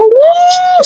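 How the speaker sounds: a sudden, very high whoop of joy; excited whoop. Retold or imitated. Artist cheering at his first English critique, high whoop. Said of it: "Sumigaw ako dun sa".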